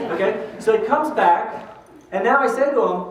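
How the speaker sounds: ordinary talk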